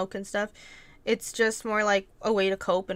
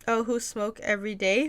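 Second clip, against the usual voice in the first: ordinary delivery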